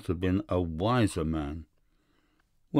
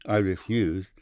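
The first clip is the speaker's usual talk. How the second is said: plain reading voice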